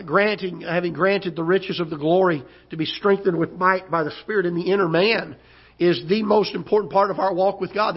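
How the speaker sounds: normal talking voice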